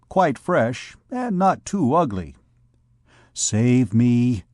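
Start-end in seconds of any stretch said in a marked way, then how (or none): none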